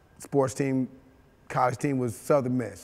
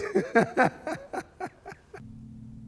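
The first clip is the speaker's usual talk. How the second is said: sudden high-pitched laugh. Real, not acted. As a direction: laugh out at the joke. Laughs at astronaut dream.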